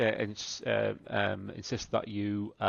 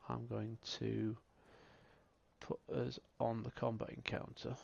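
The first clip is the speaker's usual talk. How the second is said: ordinary talk, nothing marked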